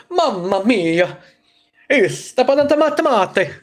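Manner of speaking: hammed-up Italian accent, drawn out. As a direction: ham it up like a cartoon Italian chef. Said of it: "I sounded Italian".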